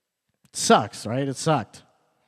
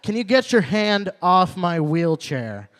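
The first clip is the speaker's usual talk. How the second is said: loud, slow, indignant snap; mock angry guy; 4 semitones higher, 6 dB louder, slower than usual. Man in wheelchair confronts the comic, loud indignant snap. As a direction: call out the clumsy stranger, loud and slow. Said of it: "he's like".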